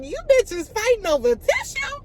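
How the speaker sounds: pitched high with scornful disbelief; incredulous mockery. Mocking the inmates fighting over her tissue, high and scornful. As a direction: sneer at the women squabbling over tissue.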